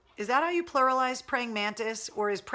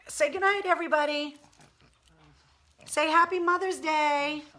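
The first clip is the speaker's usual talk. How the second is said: much higher, cutesy pet voice; high, sing-song sign-off voiced for the dog; 8 semitones higher, 3 dB louder than usual. Real, not acted. Owner voicing her pug's goodnight to viewers, high and cutesy. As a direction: say goodnight on behalf of the pug. Signing off for the pug.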